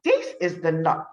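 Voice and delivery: usual speaking voice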